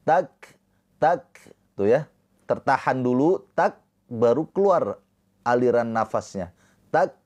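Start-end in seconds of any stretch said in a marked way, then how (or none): 0.0-1.2 Tak with a puff of air after the k; breathy kaf. Shown as a demonstration. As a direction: release a little breath on tak. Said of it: "Ada aliran nafas".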